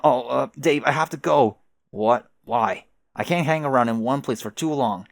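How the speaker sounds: normal talking voice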